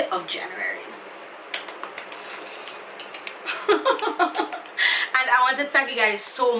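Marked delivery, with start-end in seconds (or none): 3.0-5.2 emotional laugh. Real, not acted. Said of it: "say I'm about to cry".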